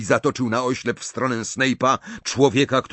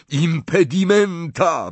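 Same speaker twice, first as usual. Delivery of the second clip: higher, slow drawn-out cry; shouted spell; 6 semitones higher, 4 dB louder, slower than usual. A narrator's reading. Harry on the ground casting at pursuers, shouting. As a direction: shout out the incantation. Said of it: "wrzasnął".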